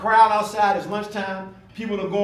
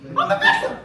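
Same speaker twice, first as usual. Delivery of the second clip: mock victim; 4 dB louder than usual. Mock self-pity, laughing.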